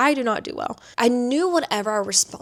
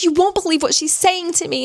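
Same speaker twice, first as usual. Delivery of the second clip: higher, louder whiny boss impression; mocking the boss; 8 semitones higher, 4 dB louder than usual. Boss complaining to advisor, mock whiny. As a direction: tattle like an outraged boss. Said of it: "She's like".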